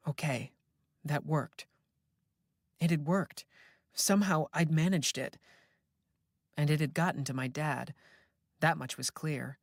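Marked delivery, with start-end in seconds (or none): none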